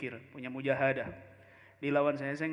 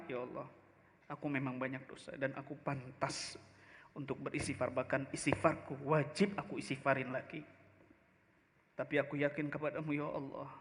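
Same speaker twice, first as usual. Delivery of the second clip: humble prayer; 4 semitones lower, 6 dB quieter than usual. Acted. Scholar's humble prayer to Allah.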